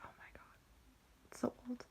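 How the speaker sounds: hushed, whispered aside; whispered disbelief. Retold or imitated. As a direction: whisper it in mock shock. Mock shock at aunt's age.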